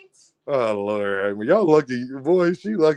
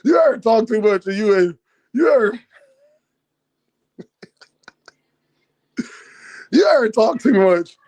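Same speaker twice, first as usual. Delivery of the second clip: laughing through it; 5 semitones higher than usual. Real, not acted. Laughing while asking.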